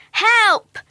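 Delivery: high, loud storybook scream. A narrator's reading. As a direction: cry out high for help.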